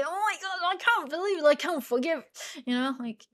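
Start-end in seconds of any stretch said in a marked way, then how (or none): 0.0-2.2 high mock-furious "I can't believe it"; mock outrage. Losing your cake to a mouse, mock outrage. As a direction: act outraged, well above normal pitch. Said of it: "And I go".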